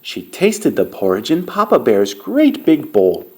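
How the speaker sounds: ordinary delivery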